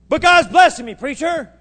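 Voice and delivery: a churchgoer boasting to the preacher about his blessings, in a loud, high mock brag; mock boastful churchgoer. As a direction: brag like a smug member of the flock, showing off to the pastor.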